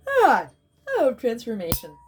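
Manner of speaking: chanting through a big yawn. Real, not acted. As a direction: let a yawn pull the words up high. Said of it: "I sound funny when I'm yawning".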